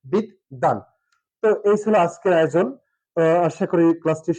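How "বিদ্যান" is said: "বিদ্যান" lower, as bid-dan; two beats with both d sounds in "বিদ্যান"; double-d demo. Shown as a demonstration. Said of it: "দুটো দ উচ্চারিত হয়েছে". ("বিদ্যান" নিচু সুরে বিদ-দান)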